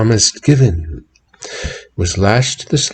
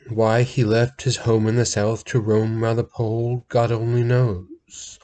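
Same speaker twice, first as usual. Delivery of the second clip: ordinary delivery, nothing marked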